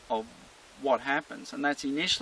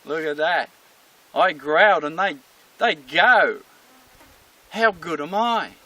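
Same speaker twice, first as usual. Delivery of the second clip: loud, emphatic self-praise; cocky dog showing off; boastful dog; 8 dB louder than usual. Acted. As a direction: brag as a very pleased dog. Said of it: "And the dog goes".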